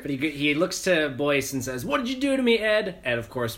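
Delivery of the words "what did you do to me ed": mock accusing. Mutated soldier confronts Boyce.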